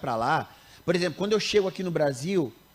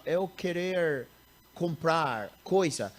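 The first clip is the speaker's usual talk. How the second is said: slow broken Portuguese, foreigner style; like an American struggling with Portuguese; American speaking Portuguese; 4 dB quieter, much slower than usual. Retold or imitated. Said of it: "como às vezes um americano". (imitando um americano falando português devagar)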